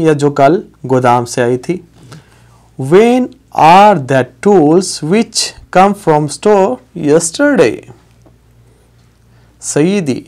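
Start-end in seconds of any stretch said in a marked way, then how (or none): 2.8-7.8 each word drawn out slowly; very slow demo. Shown as a demonstration. Teacher slowly modelling the English line. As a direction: read it slowly for beginners. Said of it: "وہ اوزار یا وہ machinery".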